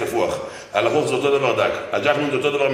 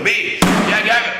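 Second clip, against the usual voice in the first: much higher, loud demand; challenging shout; 10 semitones higher, 6 dB louder, slower than usual. Real, not acted. Preacher challenges the congregation who swears innocence, shouting. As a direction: dare the room to answer.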